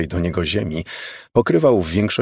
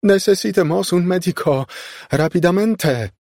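high, raised call for help; urgent cry; 11 semitones higher, 3 dB louder than usual. A narrator's reading. Maid demanding a doctor for the injured woman, crying out. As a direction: cry out in high-pitched alarm.

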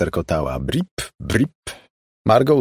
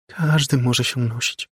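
put-on higher-pitched child voice; eerie little girl; 6 semitones higher than usual. A narrator's reading. Floating girl answers baffled Margo, high child voice. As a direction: answer as a strange small girl.

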